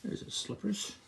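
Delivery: soft unvoiced aside; whispered aside. Real, not acted. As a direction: whisper while pointing at the picture. Aside pointing at picture.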